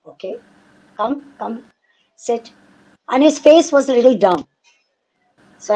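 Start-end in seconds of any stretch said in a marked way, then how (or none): 0.1-2.5 hushed, gentle, beckoning him to sit; quietly waving him in, softer voice; soft calm invite. Retold or imitated. Mother gently invites daughter's boyfriend to sit, soft and hushed. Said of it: "I said".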